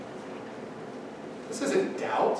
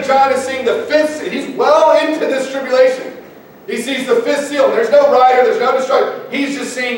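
raised, driving preacher's delivery; impassioned preaching; 7 semitones higher, 3 dB louder than usual. Acted. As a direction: preach it with mounting urgency. Preaches at full force.

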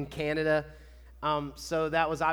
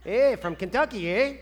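put-on playful Canadian accent on "eh"; mock Canadian "eh". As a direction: tack on a Canadian-style questioning "eh". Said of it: "the way they say".

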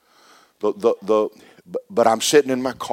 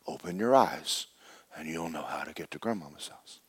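much softer, sinking to a whisper on worship; hushed lion counsel; 4 semitones lower, 9 dB quieter than usual. Acted. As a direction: softly guide him, whispering the key words. Lion's hushed instruction.

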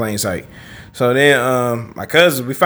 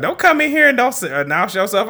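high-pitched mock scolding; indignant woman, imitated; 8 semitones higher than usual. Woman scolding the man in the bathroom, mock indignant. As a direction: imitate her scolding in a higher voice.

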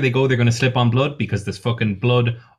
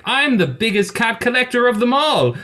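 much higher, theatrical villain boast; cat-obsessed villain; 12 semitones higher than usual. Acted. Felinus boasts about his cat collection, high and hammy. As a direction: gloat like a cartoon rich villain. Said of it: "he's like".

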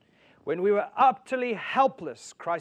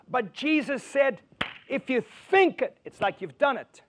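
raised, building preacher's voice; preaching; 5 semitones higher, 3 dB louder than usual. Acted. Preacher driving the point home to his church, fervent. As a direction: preach it out to the congregation. Preaching sermon at full force.

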